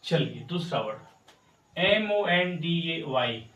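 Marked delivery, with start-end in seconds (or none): 1.8-3.4 spelled out letter by letter. Shown as a demonstration. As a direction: spell each letter out clearly. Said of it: "इसे हमको क्या पढ़ते हैं?".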